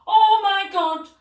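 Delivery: very high, loud mock shriek; acted panicked scream. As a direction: scream as if finding a horse indoors. Retold screaming at horse indoors.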